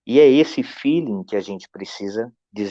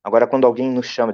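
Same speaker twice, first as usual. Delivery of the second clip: ordinary delivery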